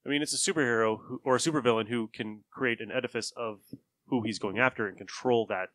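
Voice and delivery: ordinary delivery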